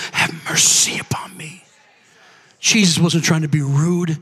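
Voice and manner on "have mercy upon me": whispered, lower plea for mercy. Acted. Woman pleading in story.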